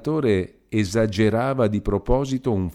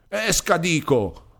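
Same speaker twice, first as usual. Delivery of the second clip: high, sharp command to get out; shouted order; 9 semitones higher, slower than usual. A narrator's reading. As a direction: bark him out of the room. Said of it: "l'altro gridò".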